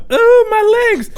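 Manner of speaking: very high, loud wailing; hammed-up sob over the legs; mock crying wail. Crying in the shower over run-over legs, loud mock wail. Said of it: "I'm in there crying".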